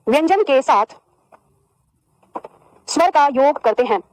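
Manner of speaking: slow, chunked dictation with pauses; feed the class each phrase to write down; unhurried dictating teacher. Shown as a demonstration. Dictating definition slowly.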